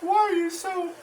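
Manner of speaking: very high put-on voice, imitating onlookers; high-pitched mimicry of a disapproving bystander; mock concerned onlooker. Onlookers questioning him after his outburst, high mocking imitation.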